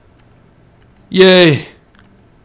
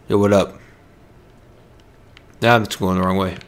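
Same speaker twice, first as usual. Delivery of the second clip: ordinary talk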